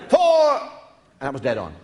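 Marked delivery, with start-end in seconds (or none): 0.1-0.6 high, loud call across the course; golf warning shout. Shown as a demonstration. Teller shows the audience how golfers shout with a loud yell. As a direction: bellow the warning to the players ahead. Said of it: "you go".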